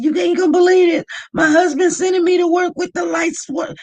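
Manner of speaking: high, loud, fast indignant outburst; you can't believe what he did, tell it loud and quick; outraged disbelief. Retold or imitated. Venting to mod on phone.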